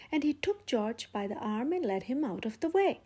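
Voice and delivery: ordinary delivery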